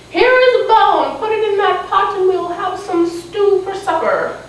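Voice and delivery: put-on high-pitched old lady voice; little old lady. Old lady giving a girl a bone for stew, high voice. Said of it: "the little old lady said".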